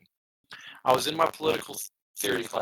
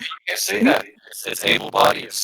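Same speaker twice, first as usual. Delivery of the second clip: mock scolding; 6 semitones higher, 5 dB louder than usual. Mock scolding of ableist speech.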